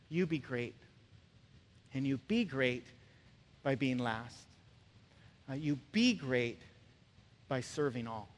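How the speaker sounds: soft, low, gentle preaching with long pauses; hushed, earnest appeal. Real, not acted. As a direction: drop right down to an intimate, sincere encouragement. Gentle appeal closing the point.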